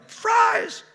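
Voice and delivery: loud, piercing bird shriek; squawking parrot. A narrator's reading. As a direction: crow it at full pitch like a parrot. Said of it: "they crowed".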